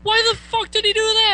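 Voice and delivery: very high and loud, incredulous; shocked friends. Retold or imitated. Friends reacting to the burp story, high and loud. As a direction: shriek it in disbelief. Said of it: "everyone's like".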